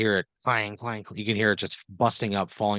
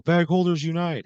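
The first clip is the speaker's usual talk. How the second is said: high-pitched rallying cry; raised, rousing battle cry; group yell; 8 semitones higher, 4 dB louder than usual. Acted. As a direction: yell it like a whole band answering. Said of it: "and they all yell".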